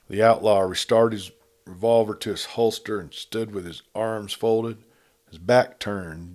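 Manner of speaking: usual speaking voice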